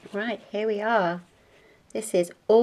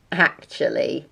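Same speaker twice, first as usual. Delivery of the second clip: much lower, lightly gallant; gallant husband; 8 semitones lower than usual. A narrator's reading. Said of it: "said Mister Large gallantly".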